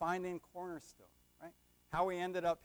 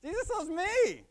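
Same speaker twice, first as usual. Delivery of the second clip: higher, mock echo of the crowd's answer; mimicking the congregation; 12 semitones higher, 8 dB louder than usual. Preacher mimics how the congregation answered him, in a high mock echo. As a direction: parrot their answer back in a higher voice. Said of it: "But you didn't say Jesus loves Tim".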